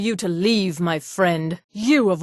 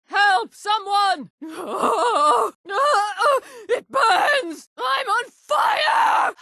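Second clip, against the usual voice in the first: high panicked screams, rising to a slow wail; frantic shrieks of pain; screaming while burning; 10 semitones higher, much slower than usual. Acted. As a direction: scream for help as the flames take you.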